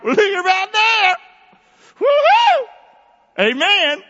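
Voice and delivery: very high, loud exultant cry; preacher celebrating with the church; joyful whoop. Real, not acted. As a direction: whoop it up with delight.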